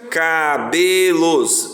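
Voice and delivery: CABELOS slowly stretched, "ca-be-los"; syllable by syllable. Shown as a demonstration. Teacher showing where hyphens go, slow syllables. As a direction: draw out every syllable of CABELOS. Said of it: "Vai K, tracinho".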